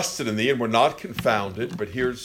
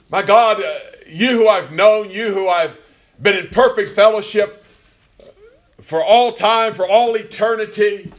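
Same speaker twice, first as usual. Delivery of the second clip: high, loud anguished plea to God; impassioned preaching; 8 semitones higher, 7 dB louder than usual. Acted. Preacher voicing the forsaken Son's plea to God, impassioned. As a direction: cry out to God in full preaching voice.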